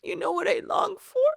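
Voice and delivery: high, emotional pleading prayer; tearful plea. Retold or imitated. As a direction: plead with God, voice lifted close to tears. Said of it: "that's when I start crying".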